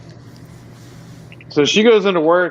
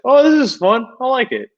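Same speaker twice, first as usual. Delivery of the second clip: high, bright mock dad voice; cheerful dad; 11 semitones higher, 4 dB louder than usual. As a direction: chirp it like an easygoing dad.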